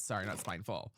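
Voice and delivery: usual speaking voice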